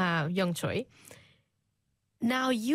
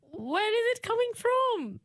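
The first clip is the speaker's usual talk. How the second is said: high squeaky disbelief, much higher; astonished; 14 semitones higher than usual. Real, not acted. Host hearing ta means thank you, high astonished squeal. Astonished New Zealander learning ta.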